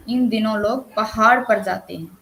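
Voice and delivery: पहाड़ given extra weight; stress on पहाड़. Shown as a demonstration. Teacher demonstrates Manish suggesting the hills, stress on पहाड़. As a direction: lean firmly on the word पहाड़. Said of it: "इन पर उन्होंने बल दिया है".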